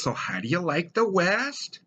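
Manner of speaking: a hint of a Midwestern grandma; little old Minnesota lady. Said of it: "And she says".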